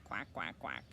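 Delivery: normal talking voice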